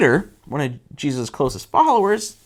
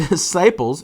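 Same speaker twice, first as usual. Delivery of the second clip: usual speaking voice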